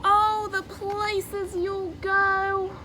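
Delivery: ordinary talk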